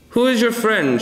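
high-pitched, lightly shouted question; strange-voiced mild shout. A narrator's reading. Walking tree calling to Peg, mildly shouted in strange voice. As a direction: pitch it way up, an odd little call. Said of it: "also in a strange voice".

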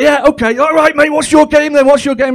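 high, loud, gushing enthusiasm; overexcited holiday rep. Retold or imitated. Animator gushing at the dad's game offer. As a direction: animator thrilled to play.

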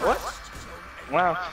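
ordinary delivery

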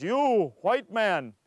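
slow, loud, deliberate chief's words; stern chief. Retold or imitated. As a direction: drag it out like a menacing chief.

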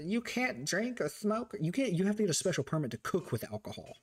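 imitated fussy prohibition; campus rule-enforcer. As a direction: recite petty rules in a mocking official voice. Mimicking strict campus rules.

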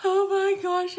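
high, loud, choked-up cry of dismay; retold tearful exclamation. Overwhelmed by sad posts, a high loud tearful oh my gosh. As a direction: blurt it out as if tearing up. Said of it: "sobbing uncontrollably".

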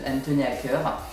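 normal talking voice

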